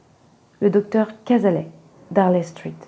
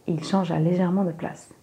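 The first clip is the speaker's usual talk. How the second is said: ordinary delivery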